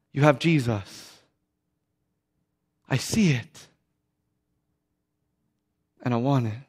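low, soft, with long pauses; subdued confession. Retold or imitated. Weeping drug dealer to the believer, subdued.

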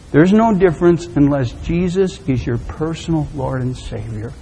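ordinary delivery

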